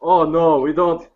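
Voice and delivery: nervous clerk in a hint of German; a hint of German, slow and pleading; German accent. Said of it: "I don't know why I'm talking German now".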